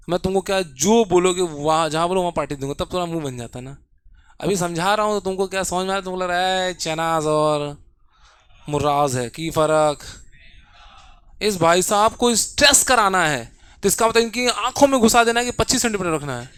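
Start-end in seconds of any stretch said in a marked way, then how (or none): none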